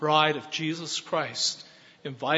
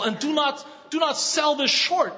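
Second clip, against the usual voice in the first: raised, high, forceful exhortation; preaching shout; 9 semitones higher than usual. Real, not acted. Preacher urging his congregation not to undervalue grace, shouting. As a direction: drive it home with a shout.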